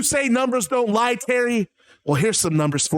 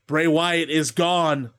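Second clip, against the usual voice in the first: ordinary talk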